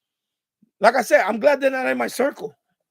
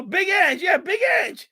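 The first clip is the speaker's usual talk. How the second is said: calling out; 8 semitones higher, 3 dB louder than usual. Acted. Hollering the name.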